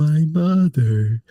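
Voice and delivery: slow, drawn-out mock line reading; mocking stilted delivery. Friend echoing the kid's wooden line, slow and mocking. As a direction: echo the wooden kid actor. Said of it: "Who talks like that?".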